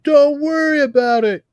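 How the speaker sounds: high, loud mock reassurance; Mazzilli. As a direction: call it out way up in pitch, drawing out the last line. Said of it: "even Mazzilli's like".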